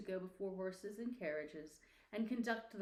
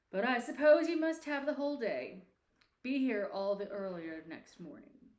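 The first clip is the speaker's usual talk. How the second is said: put-on louder, grudging old miser; grudging Scrooge; 2 semitones higher, 8 dB louder than usual. A narrator's reading. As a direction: give in grudgingly like a stingy boss. Scrooge grudgingly gives the day.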